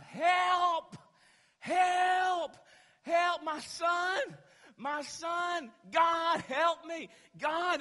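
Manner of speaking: full-force high screams for help; crying out. Retold or imitated. Father carrying his son through the neighborhood, crying out for help. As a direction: re-enact a desperate plea at the top of your lungs. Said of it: "I'm crying out".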